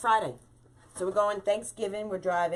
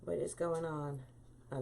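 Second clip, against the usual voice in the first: usual speaking voice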